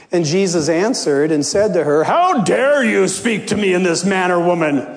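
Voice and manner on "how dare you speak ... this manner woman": a touch of an offended master's voice; mock stern rebuke. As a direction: pretend to take offence, lightly. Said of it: "Not at all, of course".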